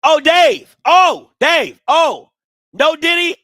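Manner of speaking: high, loud taunting yells; loud, high gloating taunts; taunting hype. Real, not acted. Taunting rival fans, hyped.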